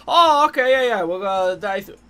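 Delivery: hammed-up flustered guard; flustered staff member. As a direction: play the caught-off-guard staff. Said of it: "They're like".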